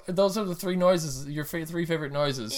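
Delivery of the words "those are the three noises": normal talking voice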